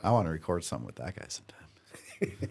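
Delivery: soft whispered aside; whispering. Real, not acted. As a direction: whisper it under your breath.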